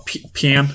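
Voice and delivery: "pain" split into "pee-an"; mock a bad Irish "pain" as "pyan"; mispronounced pain. Shown as a demonstration. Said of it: "he says it like pian".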